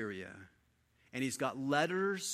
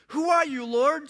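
startled question; 12 semitones higher, 9 dB louder than usual. A narrator's reading. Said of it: "Saul asked".

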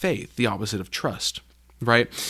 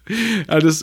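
laughing; 6 semitones higher, 6 dB louder than usual. Real, not acted. Laughing at the disciples' plight.

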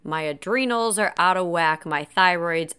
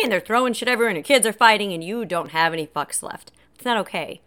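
ordinary delivery

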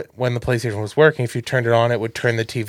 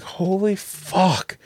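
amazed; 5 semitones higher, much slower than usual. Retold or imitated. Retold amazement.

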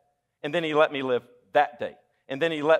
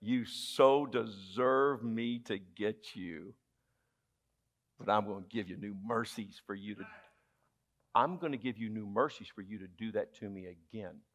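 tender God voice; 7 semitones lower, 9 dB quieter than usual. Acted. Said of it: "You know what he says?".

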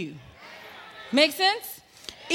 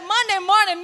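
higher, louder exclaim; mock horror; 8 semitones higher, 5 dB louder than usual. Preacher balking at the pastor's schedule, loud mock horror. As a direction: balk at the early hour. Retold horrified reaction.

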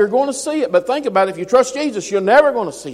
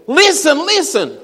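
urgent call; 8 semitones higher, 4 dB louder, slower than usual. Shown as a demonstration. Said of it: "That's simply saying".

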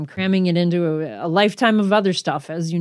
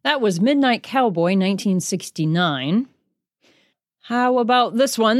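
ordinary talk

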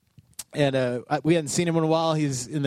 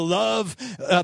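normal talking voice

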